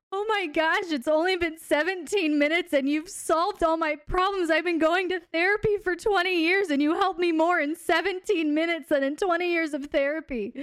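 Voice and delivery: high, loud, amazed retelling of a client; gushing client. Retold or imitated. Client amazed at the clock, gushing to her coach, loud and high. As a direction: gush like a stunned, grateful client. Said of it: "she looks at the clock and she goes".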